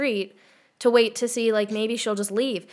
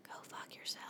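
breathy whispered curse, no voice at all; hushed cheeky swear; whispered aside; 27 dB quieter, faster than usual. Pretend. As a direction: drop to a sneaky whisper for the punchline. Mock insult to cringing listeners.

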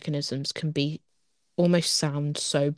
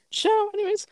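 high mock goodbye. Mock exit.

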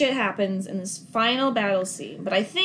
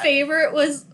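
ordinary talk

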